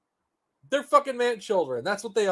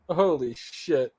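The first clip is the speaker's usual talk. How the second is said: usual speaking voice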